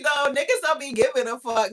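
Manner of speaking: ordinary talk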